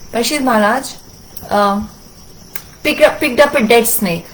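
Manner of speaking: usual speaking voice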